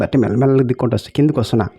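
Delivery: ordinary talk, nothing marked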